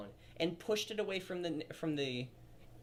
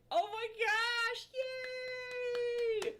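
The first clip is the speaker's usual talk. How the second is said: much higher, slow, squealing yay; mock squeal; much slower than usual. Fans squealing at Aang kissing Katara. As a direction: squeal like a fan at the kiss. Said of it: "it was like".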